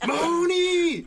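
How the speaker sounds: Mahoney shouted high through real laughter; laughing. Real, not acted. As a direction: burst out with Mahoney while cracking up. Laughing at the name mix-up.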